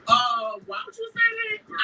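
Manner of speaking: high-pitched indignant question, re-enacted; retold upset protest. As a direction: act out a mock-hurt, high protest. Retelling her upset protest.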